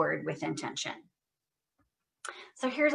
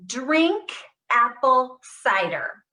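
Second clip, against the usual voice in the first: loud, every word pushed out; loud and proud; 4 semitones higher, 5 dB louder, slower than usual. Shown as a demonstration. Therapist modelling a loud drill phrase, loud and proud. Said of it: "Say it loud and proud".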